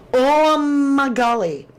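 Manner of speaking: high, loud, drawn-out oh my golly; amazed. Real, not acted. Streamer marvelling at the Sawzall theory, high and slow. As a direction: savour the shock slowly. Reacting to clue.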